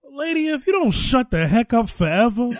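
loud exasperated scolding; angry outburst. Acted. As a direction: snap at her to shut up. Telling off woman in clip.

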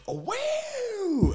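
very high, loud, held-out whoop; huge wailed rasp. Retold or imitated. Imitating his mate letting a rasp out, wildly high and loud. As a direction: let rip with a long high holler. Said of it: "you just went".

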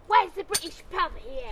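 imitating a whiny English tourist; mock a Brit abroad; British tourist voice. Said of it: "British people like".

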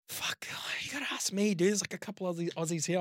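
whispered grumble, mostly breath; mock whisper. Grumbling to yourself about the Swede's questions, in a whisper. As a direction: hiss it under your breath so the Swede won't hear.

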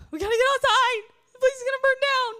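high, fast panicked shout; mock panic. Preacher mocks panicked guest in hotel, high and frantic. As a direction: shriek like someone fleeing a fire. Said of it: "I didn't run".

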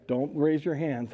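ordinary delivery

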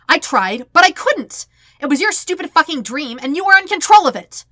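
frantic furious protest; angry yell. Acted. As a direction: shout back in self-defence. Sokka yells back defensively.

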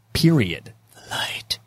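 hushed, breathy aside; a mock-solemn whisper over the light; dramatic whisper. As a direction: whisper it like a sacred rule. Mock lighthouse keeper decree.

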